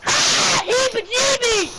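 loud high yelp; loud squeal. Real, not acted. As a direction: shriek it out.

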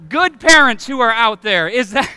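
ordinary talk

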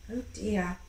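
ordinary delivery, nothing marked

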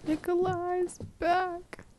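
ordinary talk, nothing marked

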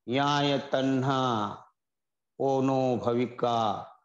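धीमा, गंभीर पाली सूत्र-पाठ; सूत्र को श्रद्धा से धीरे-धीरे पढ़ो. Acted. Recites Pali scripture verse. (पाली श्लोक का धीमा पाठ)

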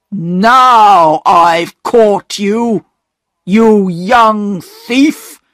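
slow, loud station master nabbing a culprit; angry shout. A narrator's reading. Station master catching Peter at the coal heap, angry shout. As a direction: bellow it slowly, catching someone in the act. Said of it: "shouted the station master".

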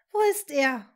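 tense angry demand; suppressed rage. A narrator's reading. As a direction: demand it through suppressed anger. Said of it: "zischte sie mit unterdrückter Wut".

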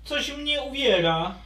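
put-on high child dragon complaining; a small dragon, squashed and whining; squeaky little dragon. Little dragon stuck in a pot, high and whiny.

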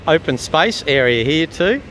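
usual speaking voice

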